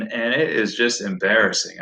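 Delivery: normal talking voice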